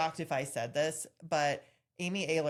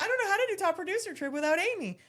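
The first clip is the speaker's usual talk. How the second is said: high, fast, loud wail; hammed-up crushed protest; mock distraught; 6 dB louder, faster than usual. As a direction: rush it out in a pitched-up plea. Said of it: "it, like, crushed me".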